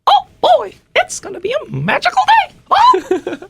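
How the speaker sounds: very high falsetto cartoon mouse; cheerful squeaky Mickey. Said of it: "To Mickey Mouse voice?".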